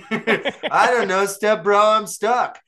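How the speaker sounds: high, loud and slow, laughing through it; mock porn line. Ben plays along with the porno joke, laughing and squeaky. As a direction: ham up a helpless stuck damsel. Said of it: "What are you doing in there".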